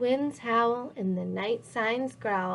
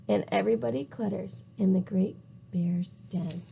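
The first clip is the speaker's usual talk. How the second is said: fast hushed whisper; 4 semitones lower, 6 dB quieter, faster than usual. A narrator's reading. Narrator whispering so as not to wake the bear. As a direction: whisper so the bear stays asleep. Animals hush near sleeping bear.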